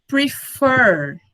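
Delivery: Prefer said slowly, weight on the last syllable; stress on second syllable. Shown as a demonstration. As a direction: draw out the end of "Prefer". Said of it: "porque ele é um oxítono".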